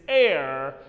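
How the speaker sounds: "air" said firmly with no h; pronunciation demo. Shown as a demonstration. Teacher corrects students on H E I R, firm "air". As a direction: say air as one open syllable. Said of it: "Be correct about the pronunciation".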